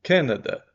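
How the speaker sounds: "Canada" with CAN loud and high; a loud teacher model of Canada; stress on CAN. Shown as a demonstration. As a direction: repeat "Canada" hitting CAN loudly. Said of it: "Olha o A".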